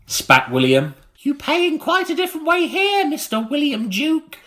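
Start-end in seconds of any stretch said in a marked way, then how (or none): 1.2-4.4 put-on much higher screeching old woman; screeching crone. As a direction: screech it like a witch. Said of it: "said Missus Claridge".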